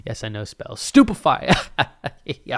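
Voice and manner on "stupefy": mock spell casting.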